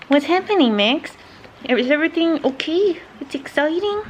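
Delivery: squeaky-high doggy voice, rising at the ends; talking to a dog. Real, not acted. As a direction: coo excited questions at your dog. Talking to her dog Max.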